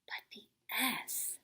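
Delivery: suspenseful whisper. Acted. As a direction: whisper a secret.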